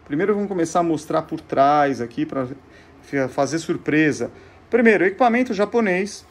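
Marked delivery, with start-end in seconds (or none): none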